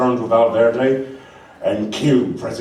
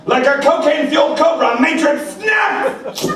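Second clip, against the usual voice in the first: much higher, loud hyped announcer; excited narration; 4 dB louder than usual. Acted. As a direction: build it like a trailer voice. Action narration.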